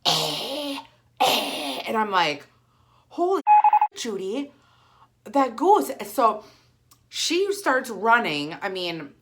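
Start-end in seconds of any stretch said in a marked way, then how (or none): 0.1-1.8 a short breathy hiss, twice, like an angry goose; imitated goose hiss. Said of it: "it hisses at her". 3.1-5.9 high, loud alarmed cry; panicked shout. Retold or imitated. Panicked shout at friend over goose.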